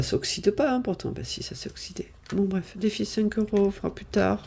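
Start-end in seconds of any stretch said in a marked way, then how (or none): 1.1-2.1 breathy whisper to herself; whispered aside. Real, not acted. To viewers, admitting the clips rusted, whispered. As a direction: mutter the correction under her breath. Aside about oxidised paperclips.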